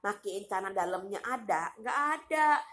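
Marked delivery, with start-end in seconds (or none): 1.7-2.6 high, loud dismissal; emphatic denial. Real, not acted. Ranting that no neighbour would help, emphatic denial. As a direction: answer your own question high and loud. Emphatic answer to own rhetorical question.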